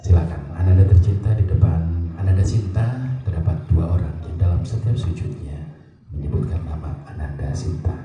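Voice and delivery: very low, solemn ceremony narration; moved, sorrowful. Shown as a demonstration. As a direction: speak as if stirring tears at a sungkem. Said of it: "Beda, ekspresinya tetap".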